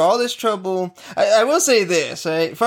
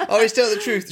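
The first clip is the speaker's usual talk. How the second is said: usual speaking voice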